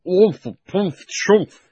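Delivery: high muffled grunts; muffled mumbling. A narrator's reading. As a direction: mumble through a hat. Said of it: "Uncle Andrew's voice from inside the hat".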